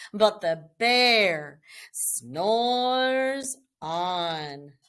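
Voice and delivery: slow, sinking read-aloud refrain; storybook refrain. A narrator's reading. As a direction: bedtime refrain read slowly.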